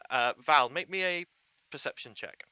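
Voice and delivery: ordinary delivery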